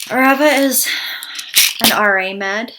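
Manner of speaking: short of breath, breathy pauses. Real, not acted. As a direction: talk while winded. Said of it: "I'm a little bit out of breath".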